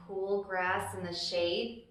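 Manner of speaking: plain reading voice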